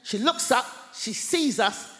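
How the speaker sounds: ordinary delivery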